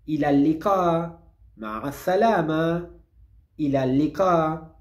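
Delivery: ila liqa and ma'a salama said slowly for repeating; slow drill of the farewells; slow review. Shown as a demonstration. Teacher reviewing the farewells for learners, slowly. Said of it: "vamos con estos cuatro saludos de vuelta".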